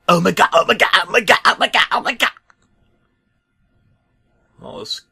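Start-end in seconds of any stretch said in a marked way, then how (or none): 0.1-2.3 high, loud frantic repeating, rising each time; panicked yelling. Acted. Truck panicking at the severed head, in frantic high yelling. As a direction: spiral into panic. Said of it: "Truck was yelling".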